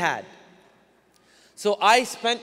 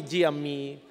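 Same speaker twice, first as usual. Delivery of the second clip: put-on low, dutiful Desi-accented reply; 5 semitones lower, 4 dB quieter than usual. Kid switching mid-conversation to mother, Desi accent. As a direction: answer mom meekly in a Desi accent. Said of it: "speak English in a Desi accent".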